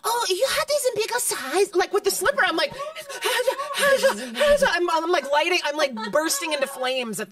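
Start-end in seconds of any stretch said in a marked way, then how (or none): 0.0-1.7 high, louder mock accent asking about sizes; hammed-up shop-counter accent; mom's put-on Chinese accent. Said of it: "Chinese accent".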